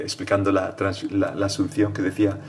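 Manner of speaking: normal talking voice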